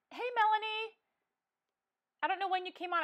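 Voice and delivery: high and loud, bright hello to a viewer; sudden happy hi; excited greeting. Real, not acted. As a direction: call out delighted to someone arriving. Spots a viewer on stream.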